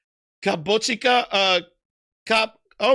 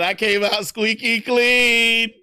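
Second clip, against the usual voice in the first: mock innocent; 5 semitones higher, slower than usual. Reads a line in a put-on voice.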